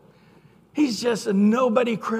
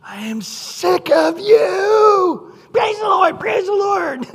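much higher, louder, mocking shout; mocking angry outburst; 13 semitones higher, 10 dB louder, slower than usual. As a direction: rant at the annoying Christians in scorn. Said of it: "one guy went off in my dorm".

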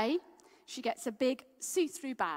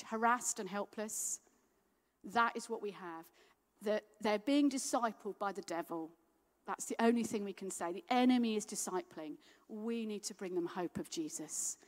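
normal talking voice

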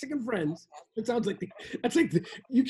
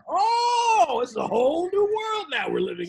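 excited; 8 semitones higher, 3 dB louder than usual. Acted. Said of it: "changed manner".

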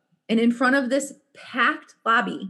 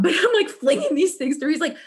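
usual speaking voice

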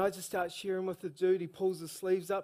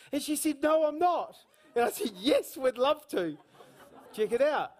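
much higher and louder, building excitement; full-force preaching; 8 semitones higher, 6 dB louder than usual. Acted. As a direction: preach it up to the crowd, thrilled.

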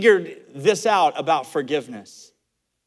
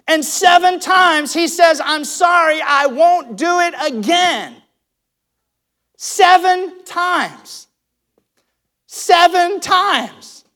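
much higher and louder, slowing on seven times; emphatic preaching; 8 semitones higher, 6 dB louder, much slower than usual. Real, not acted. Preacher stressing forgiving seven times a day. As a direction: hammer home the repeated seven times.

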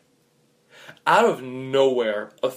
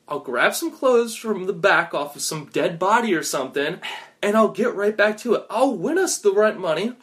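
higher-pitched, eager assurance; eager promise; 7 semitones higher than usual. Acted. Gambler promising friend the rent money, eager. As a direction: pitch it up, promising to fix things.

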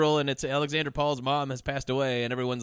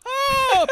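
very high, loud "oh"; mock scandalised shriek; 8 dB louder than usual. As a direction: squeal in hammed-up horror. Hammed-up scandalised outcry.

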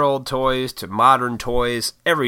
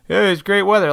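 awkward small talk; 9 semitones higher, 4 dB louder than usual. Retold or imitated. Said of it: "started some bullshit weather com".

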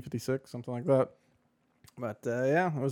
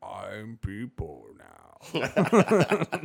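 robotic; 3 semitones lower, much slower than usual. Retold or imitated. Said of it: "McConnell was just".

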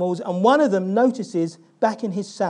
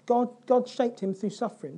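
ordinary talk, nothing marked

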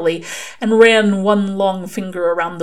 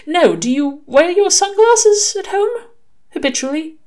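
Aziraphale, intently curious; 13 semitones higher, 5 dB louder than usual. A narrator's reading. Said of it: "when he was mulling over a prophecy".

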